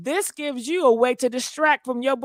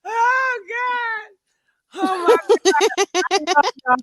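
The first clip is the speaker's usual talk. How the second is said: laughing disbelief; 7 semitones higher, much slower than usual. Real, not acted.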